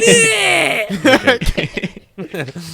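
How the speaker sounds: helpless laugh at own mistake; loud shrieking laugh. Real, not acted. Host caught calling Lenny Kenny, laughing loudly. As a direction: burst out in a high loud cackle.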